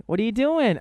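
imitating the manager chatting with his son; talking to a toddler. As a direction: coo at a little kid.

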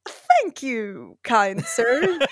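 lightly hammed-up courtly thanks; mock courtly thanks. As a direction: thank him like a gentleman of the stage. Mock-gallant reply to director.

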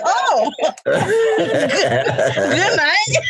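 high, laughing "good night" of disbelief; laughing in shocked disbelief; shocked laughing exclaim. Retold or imitated. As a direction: gasp it out through laughter. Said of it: "And I was like".

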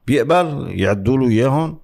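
ordinary talk, nothing marked

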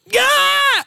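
loud, high cheer; sudden high joyful yell; elated shout. Retold or imitated. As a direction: yell it like the crowd is roaring. Show was great.

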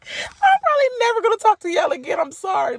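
high, loud, crying with laughter; laughing apology. Real, not acted. Telling viewers she won't text people back, laughing high. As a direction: cackle through a fake apology.

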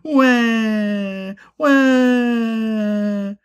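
put-on high, long baby wail; cartoonish "wah" crying. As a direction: bawl like a little one. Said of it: "Big teardrops came out".

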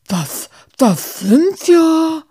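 words fail him entirely; speechless stammer. Acted. Muff stares at the rats, speechless and stammering. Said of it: "Muff verschlug es die Sprache".

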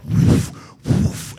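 loud, breathy burst of air; whooshing smoke noise. Retold or imitated. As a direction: imitate smoke blasting out with your breath.

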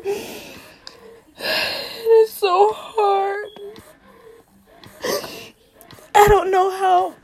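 the words come out through real sobs; breaking into sobs. Real, not acted. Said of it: "I feel like I'm about to cry now".

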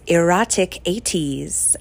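"Erotic eighties" slowly, with crisp, hard T's. Retold or imitated. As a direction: hit each T in "Erotic eighties" hard, imitating the host. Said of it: "really pronounces those T's really hard".

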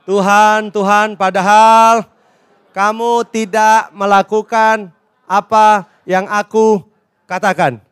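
loud, measured scripture recitation; leading unison reading. Real, not acted. Preacher leads congregation reading Lukas, loud and measured. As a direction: read slowly and loudly in separated phrases. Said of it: "Ya, sama-sama. Dua, tiga".

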